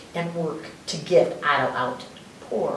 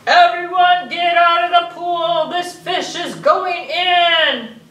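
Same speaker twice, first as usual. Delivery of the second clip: high, loud, slow, half-sung call; sing-song yell; 9 semitones higher, 8 dB louder, slower than usual. Acted. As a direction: announce it like a drill. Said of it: "The firemen yelled".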